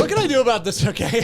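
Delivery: high, loud exasperation; acted exasperated protest; exasperated outburst. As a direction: blurt it out, fed up with the kid.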